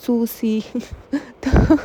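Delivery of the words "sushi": सूसी said as "soosi" with a plain s sound instead of sh; proud of his wrong "soosi"; mispronounced sushi. Retold or imitated. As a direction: say सूसी with a hard s sound, the way he misreads it. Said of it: "ये picture पर क्या है".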